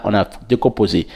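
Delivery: usual speaking voice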